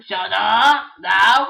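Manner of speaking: loud, slow shout. Acted. Telling the other toy to shut up, a loud slow shout. Characters quarrelling.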